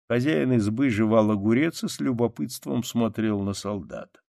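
ordinary delivery

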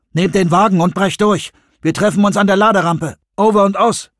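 radio command. A narrator's reading. Jones ordering the team by radio, firm command. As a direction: command them, decisive and crisp.

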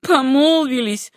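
jeering, stretched repetition; mocking echo. A narrator's reading. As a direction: draw out the word to ridicule it. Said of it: "насмешливо повторила Руфь".

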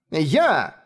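put-on mild old lady's yell; granny's yell. A narrator's reading. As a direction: claim it as a pushy granny. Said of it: "проорала бабуля".

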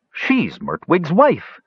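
high, drawn-out cry of discovery; exclaiming. A narrator's reading. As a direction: blurt out a startling realisation. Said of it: "several elves exclaimed at once".

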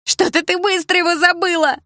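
very high, loud shriek; hysterical shout. Acted. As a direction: scream it in hysterics. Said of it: "выкрикнула Саша".

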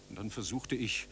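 ordinary talk

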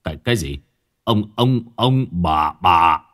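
choked, stumbling shock; stammering. Acted. Father sputtering at the news of a grandchild, stammering. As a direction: sputter the words out after coughing. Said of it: "sau đó ngước lên ú ớ".

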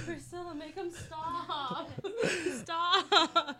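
slow giggling plea; laughing. Real, not acted. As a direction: beg through the giggles. Begging through drunk giggles.

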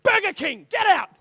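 loud call. Retold or imitated. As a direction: order him out of the van. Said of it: "He goes".

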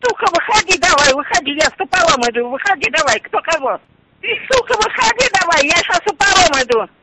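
screaming threats; yelling at the neighbour; angry. Real, not acted. Axe threats, shouting.

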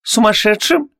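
high, rising echo-question; skeptical. A narrator's reading. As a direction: query it doubtfully in a woman's voice. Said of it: "скептически хмыкнула Ванесса".

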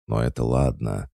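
ordinary delivery, nothing marked

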